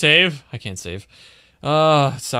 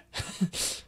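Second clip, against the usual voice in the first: soft, breathy chuckle; breathy laugh; 9 dB quieter than usual. Real, not acted. Player laughing after the ordeal, breathy laugh. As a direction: let out a quiet airy laugh.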